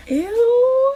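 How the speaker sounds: very high, drawn-out ew; acted-out offended recoil; disgusted ew. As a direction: squeal it in mock disgust. Reacting to friend's insult.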